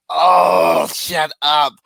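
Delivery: loud, fed-up brush-off; half-mocking irritated snap; exasperated shut up. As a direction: dismiss her like she's grating on you. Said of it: "I just was like".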